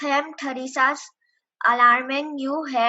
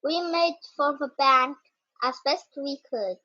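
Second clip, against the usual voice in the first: ordinary delivery, nothing marked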